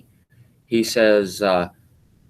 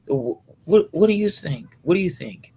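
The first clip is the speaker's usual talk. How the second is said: imitating pushy coworkers; 9 semitones higher than usual. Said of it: "bring the story to me".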